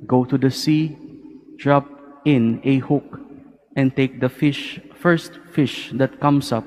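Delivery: formal liturgical reading voice; Gospel reading. Acted. Priest proclaims the Gospel reading.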